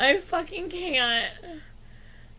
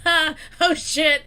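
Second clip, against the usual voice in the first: mock nervous crowd laugh, raised in pitch; imitated laughter; 3 semitones higher, 4 dB louder than usual. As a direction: act out stunned people starting to laugh. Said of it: "like, laughing".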